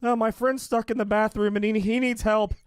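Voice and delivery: high, loud urgent plea; high urgent prankster voice; mock urgent. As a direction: sound worried, high and loud. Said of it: "he was like".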